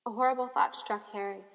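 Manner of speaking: ordinary delivery, nothing marked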